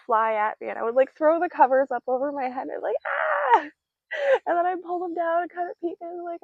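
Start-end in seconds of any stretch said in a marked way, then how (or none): 3.1-3.7 short high squeal of mock fright; high playful shriek. Bat flies at her face, playful high squeal. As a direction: squeal as if ducking under covers.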